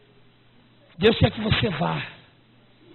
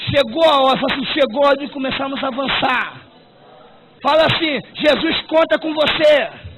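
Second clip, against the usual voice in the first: full-force preaching; 3 semitones higher, 5 dB louder than usual. Acted. Pastor urging his church at full force, loud preaching. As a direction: preach it out, building the crowd up.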